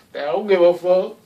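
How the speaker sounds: lightly imitating someone who doesn't care; a touch of the careless show-off; mock careless tone. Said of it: "They actually tell you that".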